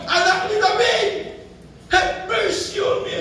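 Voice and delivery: high pleading cry for mercy. Retold or imitated. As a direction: call out to be heard. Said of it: "siya'y sumisigaw".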